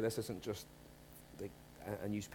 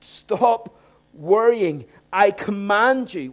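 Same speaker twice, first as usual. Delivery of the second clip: slow, loud and high, every word a command; commanding shout; 7 semitones higher, 11 dB louder, much slower than usual. Shown as a demonstration. Preacher to congregation, Paul's command as a slow loud shout. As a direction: bellow it like Paul's capital-letter order. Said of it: "capital letters just for emphasis with a big exclamation mark".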